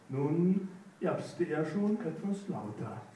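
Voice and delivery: plain reading voice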